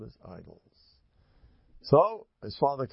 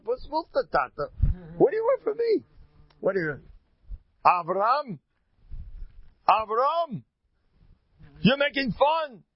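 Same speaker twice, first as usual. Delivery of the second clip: father's angry rebuke; 9 semitones higher than usual. Acted. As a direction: scold him sharply, climbing high. Father angrily rebukes Avram.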